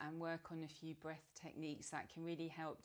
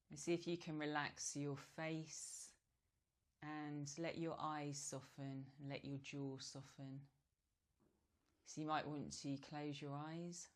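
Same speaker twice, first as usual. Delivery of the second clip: guided meditation. Real, not acted. Yoga teacher guiding relaxed breathing, calm meditation voice. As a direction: ease them gently into stillness, leaving space between phrases.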